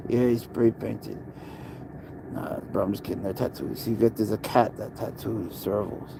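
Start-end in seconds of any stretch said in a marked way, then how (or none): none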